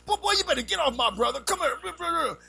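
loud, high shout from the playground; protective brothers shouting. Retold or imitated. Imagined brothers shout across the playground to defend him, loud and high. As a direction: yell like big brothers coming to help. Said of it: "at least they could say".

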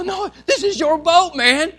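high, exasperated comeback; indignant retort. Retold or imitated. Preacher replays arguing with brother over the boat, indignant. Acted argument in sinking boat.

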